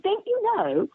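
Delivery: pitched well up, teasing the question; high mock director voice. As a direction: tease it up high. Quoting the director on phone.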